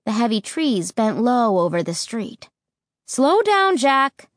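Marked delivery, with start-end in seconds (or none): 3.0-4.3 high, shrill mother's alarm; shrill warning. A narrator's reading. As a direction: warn the driver shrilly, pitched right up. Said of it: "Mom warned shrilly".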